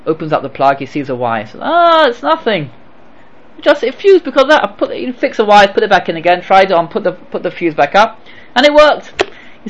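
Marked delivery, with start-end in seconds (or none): 1.6-9.1 light, high easygoing voice. Retold or imitated. Kindly electrician explains.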